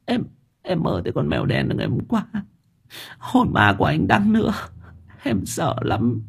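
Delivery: high, soft, tearful stammer; timid, high-pitched fearful confession; frightened stammering. A narrator's reading. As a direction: stumble over the words in fear. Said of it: "Vi lắp bắp".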